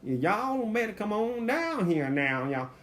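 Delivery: high, drawn-out Texas whine; whining Texas accent. Retold or imitated. Texan calling folks down here, high slow whining Texas accent. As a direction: stretch the vowels in a whiny Texas twang. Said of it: "that little whining voice".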